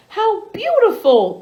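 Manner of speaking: delighted exclaim. Acted. Queen admiring Betty's rainbow, exclaiming with delight. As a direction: gasp it out in admiration. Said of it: "the queen exclaimed".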